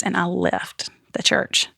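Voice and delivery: usual speaking voice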